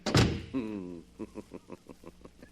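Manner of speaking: long gloating villain's laugh; a drawn-out sinister cackle; evil laugh. Acted. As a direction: laugh as the door shuts on them. Villain laughs as guests leave.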